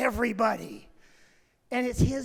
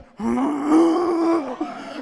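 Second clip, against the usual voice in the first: sharp gasp of shock; loud shocked gasp; 3 semitones higher, 7 dB louder than usual. Retold or imitated. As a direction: gasp as if horrified. Reacting to bloody man.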